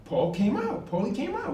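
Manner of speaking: retold shock at a friend's news; stunned disbelief. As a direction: say it back like you can't believe it. Repeating the shocking news aloud.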